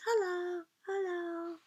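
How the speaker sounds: tiny high squeaky dog voice; voicing the dog. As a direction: speak for the puppy in a tiny high voice. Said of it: "She says".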